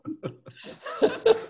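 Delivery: a breathy laugh that jumps high. Real, not acted. Comedian laughing at his own after-show prayer, high and breathy. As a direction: let a high little laugh slip out.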